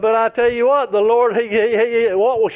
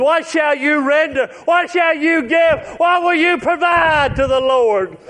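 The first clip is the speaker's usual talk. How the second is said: higher, pressing preacher questions; preaching; 5 semitones higher than usual. Acted. Preacher challenging the congregation, fervent. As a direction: drive each question home to the pews.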